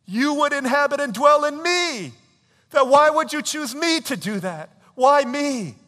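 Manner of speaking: very high, loud exclamation; acted outburst at full voice; overwhelmed astonishment. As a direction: cry out in disbelieving awe. Acting Mary's astonished worship.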